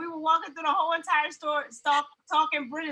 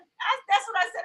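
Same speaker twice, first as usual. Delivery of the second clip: usual speaking voice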